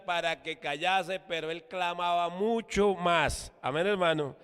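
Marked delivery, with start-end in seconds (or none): none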